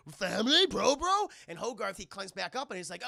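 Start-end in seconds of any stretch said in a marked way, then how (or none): 0.1-1.3 high, loud questioning robot voice; confused robot. Retold or imitated. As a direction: imitate a bewildered giant.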